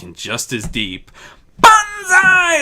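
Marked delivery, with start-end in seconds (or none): 1.6-2.6 loud, high whoop; gleeful battle cry. Acted. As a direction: yell it as you leap in. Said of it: "Azura cried out".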